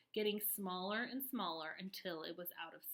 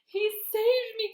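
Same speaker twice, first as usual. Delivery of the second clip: high loud slow gasp of relief; 13 semitones higher, 13 dB louder than usual. A narrator's reading. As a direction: gasp it out breathless. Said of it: "gasped the centipede".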